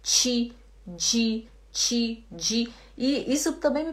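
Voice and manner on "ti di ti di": "ti, di" softened to chi, dji; Belém chi and dji. Shown as a demonstration. Teacher from Belém showing her accent, "ti, di" as chi, dji. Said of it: "fala muito esse ti".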